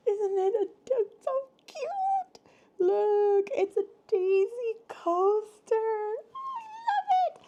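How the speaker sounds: very high, loud squealing near tears; teary squeals. Real, not acted. Vlogger showing the daisy coaster, squealing tearfully.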